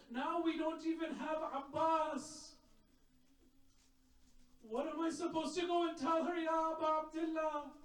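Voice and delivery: rising lament, chanted and pleading, building in pitch; impassioned mourning sermon. Acted. Preacher recounting Karbala to mourners, rising lamenting chant. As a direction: preach it like a grieving elegy, voice climbing.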